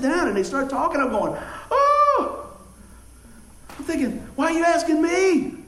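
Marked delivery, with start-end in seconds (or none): 1.7-2.5 high, loud shocked exclamation; startled oh. Retold or imitated. Retold shock at being asked. 4.3-5.5 high, loud incredulous question; as if you can't believe they asked you; bewildered. Retold or imitated. Retold inner thought, shock.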